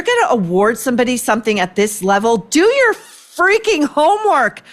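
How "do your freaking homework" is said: high, drawn-out exasperated demand; exasperated outburst. Real, not acted. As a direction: scold them like lazy students. Exasperated rant at government.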